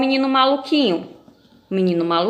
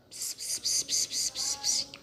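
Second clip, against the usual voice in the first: very soft unintelligible secret whisper; 15 dB quieter, slower than usual. Acted. Juninho passing the secret to Julieta, whispering. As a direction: whisper "ps, ps" into someone's ear.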